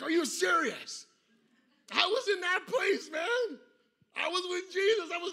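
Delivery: a friend pulled back from heaven, furious; mock outrage. Matt imagined complaining about being called back, loud mock outrage. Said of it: "He's like".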